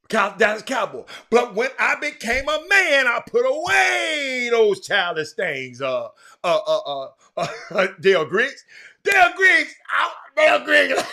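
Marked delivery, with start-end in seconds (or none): 1.3-5.8 high, raised declamation with stretched words; big, drawn-out recital; declaiming. Acted. Mock-solemn recital of scripture verse. 9.0-11.0 high, loud shout-out breaking into laughter; excited holler. Real, not acted. Hollers greeting to a listener, laughing.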